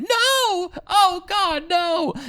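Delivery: high-pitched mock scream at normal volume; imitated screaming woman. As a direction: imitate a woman crying out in distress. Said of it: "your impression of the woman screaming".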